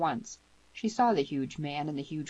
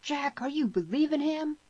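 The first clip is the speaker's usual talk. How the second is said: high-pitched, incredulous shout with moderate force; narrated shout; 11 semitones higher than usual. A narrator's reading. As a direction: demand it, voice jumping high. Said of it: "he shouted".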